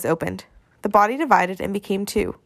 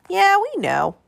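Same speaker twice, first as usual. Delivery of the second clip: high-pitched mock growl of a little boy; indignant kid's growl, played high; growled kid retort; 11 semitones higher, slower than usual. A narrator's reading. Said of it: "growled Missing Tooth".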